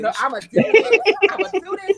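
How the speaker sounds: laughing high and loud. Real, not acted. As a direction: insist through real laughter. Insists through laughter.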